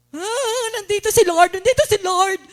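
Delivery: loud, carried-away cry of "nandito si Lord"; mock ecstatic worshipper. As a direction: ham up a worshipper swept away by feeling. Imitating emotional worshipper.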